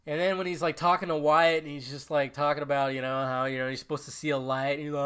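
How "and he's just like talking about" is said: ordinary talk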